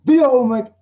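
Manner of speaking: high, loud furious order; angry command. Shown as a demonstration. Angry teacher ordering learners to do homework. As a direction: bark the order in anger. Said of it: "shows the strong emotion of anger".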